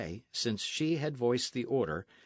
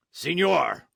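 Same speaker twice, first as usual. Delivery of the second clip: outraged roar, much louder and higher; 7 semitones higher, 9 dB louder than usual. Acted. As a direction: roar it at the captain in fury. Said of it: "roared the highwayman".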